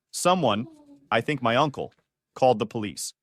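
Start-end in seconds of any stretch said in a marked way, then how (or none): none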